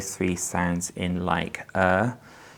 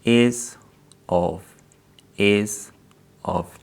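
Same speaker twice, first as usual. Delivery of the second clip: Is and of said slowly, word by word; teaching word drill; 3 dB louder, much slower than usual. Shown as a demonstration. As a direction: model Is, then of, as a drill. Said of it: "Join in with me".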